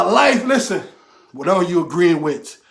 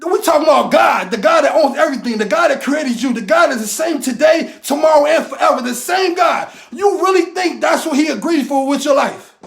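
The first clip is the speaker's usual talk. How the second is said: raised, higher, driving sermon voice; fired-up motivational preaching; preaching at full force; 5 semitones higher than usual. Acted. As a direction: preach it hard and drive every question home. Preaching about God's power.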